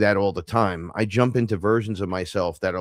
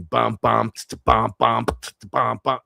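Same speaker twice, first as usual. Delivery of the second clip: rhythmic bump-bump with a t- between; beatbox the chord hits in time; vocalized chord rhythm; 2 semitones higher, slower than usual. Retold or imitated. Showing hip hop chords to listener, rhythmic bumps.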